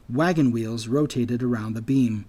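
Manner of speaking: plain reading voice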